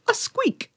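tiny high-pitched squeak of a startled nerd. Acted. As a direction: squeak it out small and high, like someone caught off guard. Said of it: "he lets out kind of".

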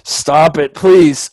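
half-laughing, begging the hiccups to quit; pleading with hiccups. Real, not acted. Begging her hiccups to stop.

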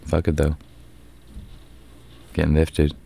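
ordinary talk, nothing marked

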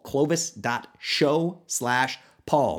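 very slow, each word of Clovis dot show slash paul set apart; slow spell-out. Shown as a demonstration. Host slowly dictates the URL for listeners. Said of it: "easiest U R L of all time".

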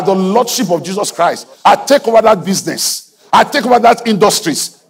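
high, loud declaring; preaching. Acted. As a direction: command the crowd to claim it. Preaching at full force.

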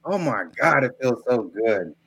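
muffled bad mic sound; underwater gurgling voice. Real, not acted. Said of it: "Why does it sound like you're underwater?".

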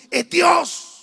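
loud, high burst of conviction; emphatic preacher shout. Real, not acted. Preacher telling church who provides, emphatic shout. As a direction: shout it as the clinching point. Preacher hammering home his point.

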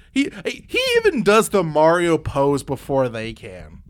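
ordinary delivery

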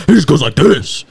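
lightly put-on loud-talker voice; a hint of a comic strained impersonation; mock friend's voice. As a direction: imitate a friend's loud way of talking. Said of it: "His voice, he just".

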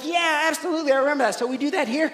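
high, loud, keen agreement; eager newcomer. Acted. Newcomer from the old kingdom checks with the veteran, eager. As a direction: play the keen recruit sure of the answer. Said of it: "you step in, you're like".

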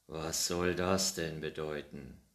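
a hint of real annoyance; grumbling scold. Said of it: "schimpfte der Alte".

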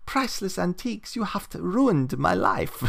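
high, wailing old lady in a bad Swiss accent; horrible Swiss accent. Old lady wails over her wrecked house, in a hammed-up high Swiss accent. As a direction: ham up a fake Swiss accent as a distraught old woman. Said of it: "That's me in a horrible Swiss accent".

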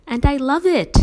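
ordinary delivery, nothing marked

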